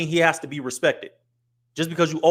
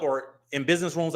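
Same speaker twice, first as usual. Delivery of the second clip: normal talking voice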